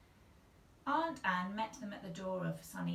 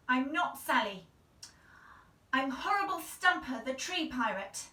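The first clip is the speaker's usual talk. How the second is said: higher, louder playful pirate boast; pretend pirate; 5 semitones higher, 8 dB louder than usual. Girl in the tree insisting she's a pirate, playful boast. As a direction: announce yourself as a pirate.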